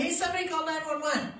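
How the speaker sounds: high, unfocused appeal to nobody in particular; vague call to a crowd. Shown as a demonstration. Instructor demos the wrong way, calling vaguely to a crowd, high and unfocused. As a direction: call out to anyone, hoping someone acts. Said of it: "what happens if you have a crowd".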